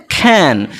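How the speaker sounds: খ্যান with a breathy kh onset, pitch falling; খ্যান said alone, aspirated and falling. Shown as a demonstration. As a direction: model খ্যান slowly with a puff after the k. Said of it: "উচ্চারণটা কী হবে?".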